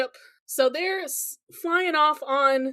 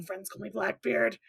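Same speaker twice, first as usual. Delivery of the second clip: lower, softer, a hint of a gruff pirate; Blackbeard's low voice; 6 semitones lower, 9 dB quieter than usual. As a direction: drop low and soft like a pirate introducing himself. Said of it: "He's like".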